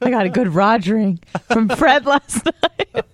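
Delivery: slow, laughing high voice; giggling girly voice. Retold or imitated. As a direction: giggle through the gossip. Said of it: "talks like that".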